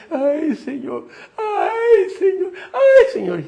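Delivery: high, wailing mock sobs of a woman; imitating a crying woman. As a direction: wail like a weeping churchgoer at the altar. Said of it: "hay una señora llorando".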